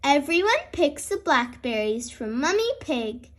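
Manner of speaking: plain reading voice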